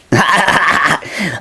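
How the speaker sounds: high-pitched burst of laughter. Real, not acted. Joker after his home buyer punchline, high laughter.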